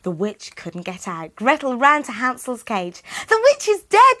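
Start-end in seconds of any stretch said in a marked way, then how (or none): 3.3-4.2 very high, loud joyful shout; joyful cry. Acted. Gretel runs to Hansel's cage, high joyful shout. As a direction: cry out with relief and joy. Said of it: "she cried".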